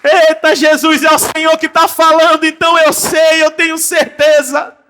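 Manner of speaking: loud fervent preaching; loud prayerful proclamation; fervent declaration. Acted. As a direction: declare it with full faith. Preaching climax, declaration of faith.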